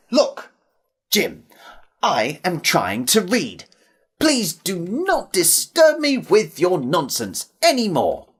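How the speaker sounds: emphatic, word-by-word telling-off; irritated, with firmly punched words; mildly exasperated. A narrator's reading. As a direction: scold him for disturbing your reading. Annoyed man scolds the interrupting boy.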